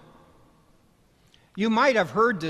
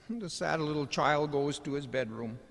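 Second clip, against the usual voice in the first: normal talking voice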